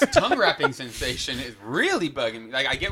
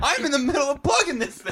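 mock exasperated; 13 semitones higher than usual. Insists after being interrupted.

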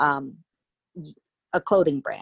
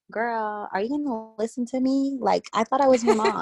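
mock exasperated mom; 6 semitones higher than usual. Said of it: "there's times where I'm just like".

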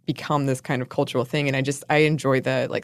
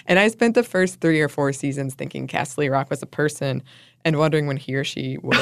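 ordinary delivery

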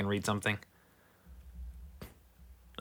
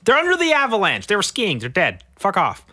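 sarcastic outburst; 7 semitones higher, 5 dB louder than usual. Real, not acted. Mocking avalanche headline.

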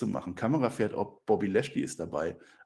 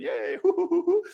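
much higher, gleeful whoops; giddy cheer; 4 dB louder than usual. Acted. [name] dancing with his bunnies, high giddy whoops. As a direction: squeal with delight while dancing.

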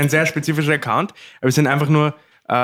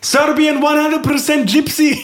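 mock commenter voice; 11 semitones higher, slower than usual. Reading comments aloud in a put-on voice.

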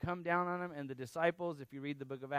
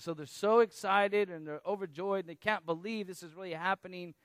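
normal talking voice

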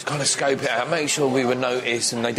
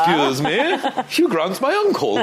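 high-pitched, affronted English gent act; hammed-up English; 9 semitones higher, 3 dB louder than usual. Englishman retelling his retort to an American jock, hammed-up English accent. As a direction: ham up the Englishness, higher and indignant. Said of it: "That's like your man from Love Actually".

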